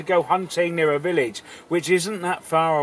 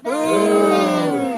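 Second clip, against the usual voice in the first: shouted boo; 6 semitones higher than usual. Acted. Crowd-style booing.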